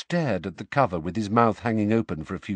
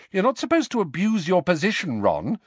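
sharp scolding, higher; 9 semitones higher than usual. A narrator's reading. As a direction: snap a reproach at him. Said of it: "said Hermione sharply".

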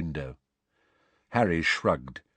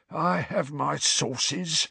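slow smug hiss; self-satisfied hiss; 8 semitones higher, much slower than usual. A narrator's reading. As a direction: hiss it out, pleased with himself. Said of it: "in a self-satisfied hiss".

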